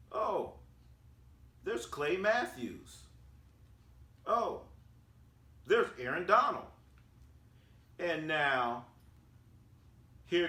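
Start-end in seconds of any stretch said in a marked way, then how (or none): 0.1-6.7 spot each star with a high, rising "oh"; excited star sighting. Retold or imitated. Acts out spotting star players.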